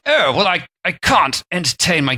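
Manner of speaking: a put-on English accent, a touch haughty; posh English accent. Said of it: "let's assume he's English just for the sake of the accent".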